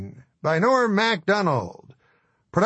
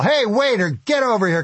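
yelling; 5 semitones higher than usual. Retold or imitated. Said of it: "And I yell".

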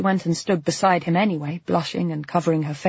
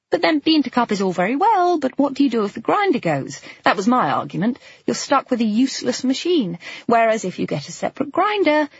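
raised, lively woman's voice; Claire's voice; 6 semitones higher than usual. A narrator's reading. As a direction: lift it into the lighter female character. Claire arguing about coffee machines.

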